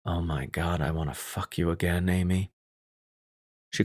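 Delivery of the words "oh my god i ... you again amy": whispering. A narrator's reading. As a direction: breathe it close to her ear. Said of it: "so I could whisper in her ear".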